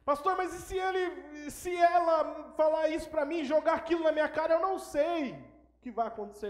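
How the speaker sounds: high, shouted mock protest; loud, high-pitched acted panic; agitated objector. Imitates an anxious objecting listener.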